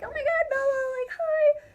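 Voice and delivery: high, loud squealing greeting; imagined freakout. Retold or imitated. The star-struck fan she expected to be, squealing high and loud. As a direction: act out the freakout she feared. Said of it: "I thought that I would freak out".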